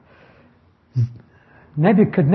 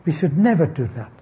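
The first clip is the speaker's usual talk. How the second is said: normal talking voice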